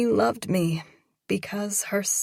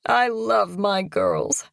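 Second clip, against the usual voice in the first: tender mother; 6 dB louder, slower than usual. Acted. Mother hugs her girls.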